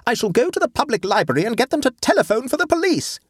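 fast, high-pitched threat; outraged, hurried declaration; indignant announcement. A narrator's reading. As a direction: announce it hotly in a rush, voice way up. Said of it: "announced Adela".

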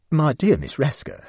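calmly indulge her doubt; patient. Acted. Scientist soothing a doubting guest, patient. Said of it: "said the wonder-worker patiently".

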